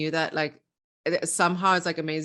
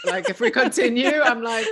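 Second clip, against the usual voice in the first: louder and higher while laughing; laughing while holding back tears; 8 semitones higher, 8 dB louder than usual. Real, not acted. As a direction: laugh through the words. Laughing, moved.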